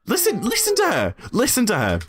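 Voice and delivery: indignant, voice leaping way up; high-pitched protest. Real, not acted. Streamer urging chat to hear the clip, high-pitched protest. Mocking the clip's speaker.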